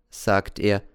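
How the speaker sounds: usual speaking voice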